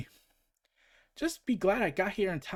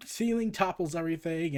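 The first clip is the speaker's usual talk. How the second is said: ordinary talk, nothing marked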